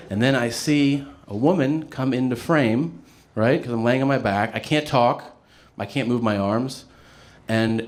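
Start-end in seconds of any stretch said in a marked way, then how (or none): none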